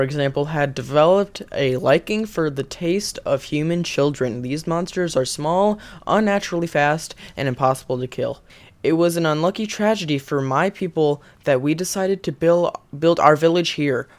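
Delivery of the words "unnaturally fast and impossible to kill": usual speaking voice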